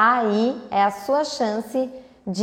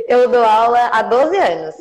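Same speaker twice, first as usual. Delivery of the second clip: laughing; 4 dB louder than usual. Real, not acted. Laughs at her own age slip.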